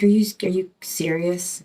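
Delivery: usual speaking voice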